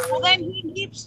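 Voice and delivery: normal talking voice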